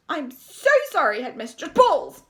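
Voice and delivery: loud, high apology breaking into a shout; flustered apology. A narrator's reading. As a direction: apologise urgently, then blurt the last word.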